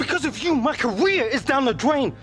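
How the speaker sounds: angry shouting. Acted. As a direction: blame him at full voice, fast at the end. Player confronts his agent.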